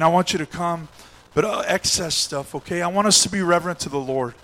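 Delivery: usual speaking voice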